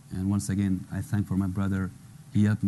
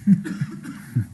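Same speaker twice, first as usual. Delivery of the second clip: breathy laugh; 8 semitones higher than usual. Real, not acted. Laughs after praising mother.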